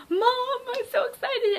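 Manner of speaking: high-pitched excited squeal to mom; excited freak-out. Retold or imitated. Fan telling mom she's so excited, high squeal. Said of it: "freaking out".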